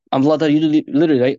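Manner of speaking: usual speaking voice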